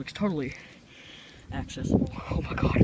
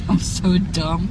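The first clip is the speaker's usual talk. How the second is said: normal talking voice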